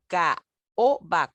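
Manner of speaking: "caoba" broken into CA, O, BA; "caoba" in three detached syllables; vowels separated. Shown as a demonstration. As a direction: spell out "caoba" syllable by syllable, A apart from O. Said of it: "Cuando dos vocales abiertas se separan".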